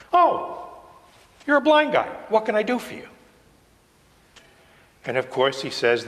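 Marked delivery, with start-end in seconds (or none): 0.1-3.1 high, casual sudden realization; comic everyday Jesus, pitched well up; conversational Jesus. Acted. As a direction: act out just noticing, then offer help casually. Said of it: "it's more conversational than that".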